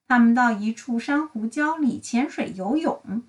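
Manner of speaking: ordinary delivery, nothing marked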